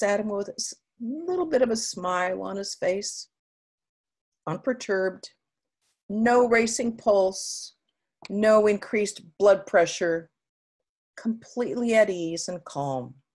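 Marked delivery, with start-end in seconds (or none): none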